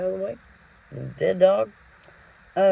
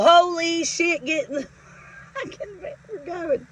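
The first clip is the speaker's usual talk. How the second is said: half-laughing rush to leave; urgent exclaim; 11 semitones higher than usual. Retold or imitated. Worker urging partner to leave, high and laughing. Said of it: "And I go".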